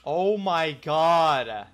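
loud, slow, stunned gasp of words; shocked exclamation. Real, not acted. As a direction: blurt it out in shock. Startled in deep water.

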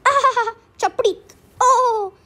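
high wailing nonsense cries; crowd's chant. Acted. Storyteller repeating the villagers' cries, high wailing.

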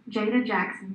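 usual speaking voice

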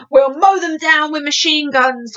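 slow, mildly shouted threat; military cry. Acted. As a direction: declare it loudly as an army chief. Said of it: "cried the head of the army".

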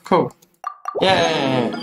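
normal talking voice

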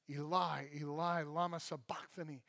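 low, soft repeat of the cry; quiet recital. A narrator's reading. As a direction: say it softly and low, reflectively.

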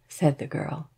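usual speaking voice

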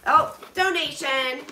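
usual speaking voice

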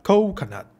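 "coconut" with COH pitched high, then falling; stress first syllable. Shown as a demonstration. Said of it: "La primera sílaba". ("coconut" con fuerza en la primera sílaba)

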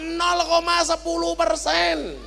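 normal talking voice

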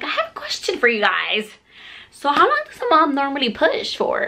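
high-pitched mock-innocent question; questioning the nurses.

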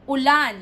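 "Ulan" quick, with a loud LAN; quick final stress. Shown as a demonstration. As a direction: snap through u to LAN in "Ulan". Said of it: "You have to read it fast".

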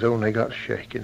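ordinary delivery, nothing marked

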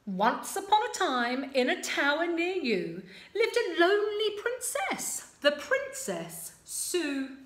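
put-on lively read-aloud for children; storytime narration. A narrator's reading. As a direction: draw the little ones in.

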